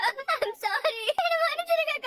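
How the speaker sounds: tearful, voice breaking, rising high on the plea; crying apology. Acted. Pallavi begging her sister-in-law's forgiveness, in tears. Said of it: "அழாத".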